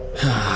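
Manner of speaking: short exasperated sigh; frustrated huff. Acted. Boy giving up on asking, frustrated huff. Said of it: "huffed in frustration".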